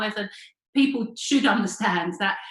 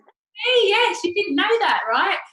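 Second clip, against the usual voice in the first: excited reveal; 12 semitones higher, 7 dB louder, slower than usual. Real, not acted. Revealing she was the shouter.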